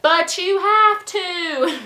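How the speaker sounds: loud, high mock whine, stretched out; whiny insistence. Host refused a drink by visitors, loudly whining. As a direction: plead like a spoiled drunk host.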